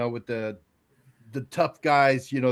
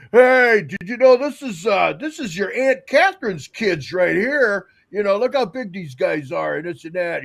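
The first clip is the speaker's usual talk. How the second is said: loud, higher-pitched jovial greeting; acted-out hearty dad; imitating breezy dad; 4 semitones higher, 5 dB louder than usual. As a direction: greet relatives loudly as if at a party. Voices dad's breezy funeral greeting.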